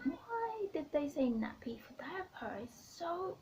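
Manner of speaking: mock puzzlement, voice riding high; baffled question. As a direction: replay your bafflement, pitch lifted. Retold baffled question to husband.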